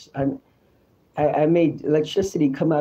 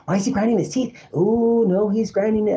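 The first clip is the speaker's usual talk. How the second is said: higher-pitched imitation of nosy bystanders; gossiping onlookers; 7 semitones higher than usual. Onlookers talking about a man in pain, mimicked gossip voice. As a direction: mimic people remarking on him. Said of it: "I could hear people talking about me".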